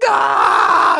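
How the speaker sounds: piercing, very loud acted scream; panicked yell. Stoned teen after the glass cracks, panicked yell. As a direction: shriek at the top of the range. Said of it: "I'm just, like, yelling".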